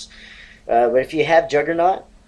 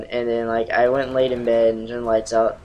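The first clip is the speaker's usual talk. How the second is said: usual speaking voice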